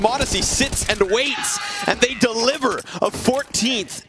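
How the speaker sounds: high hyped play-by-play; esports caster. Real, not acted. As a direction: call the multi-kill. Live play-by-play.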